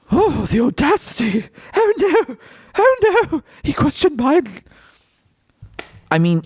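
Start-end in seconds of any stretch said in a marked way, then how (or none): 0.1-4.6 very high, fluttery pretend horror; mock aghast gasping. Room aghast that someone questioned Biden, exaggerated shock. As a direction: gasp it out, hammed-up scandal. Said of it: "they're gasping like".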